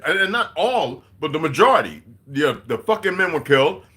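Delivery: loud, high-pitched angry rant; angry tirade. Real, not acted. Streamer telling off a chatter, heated scolding.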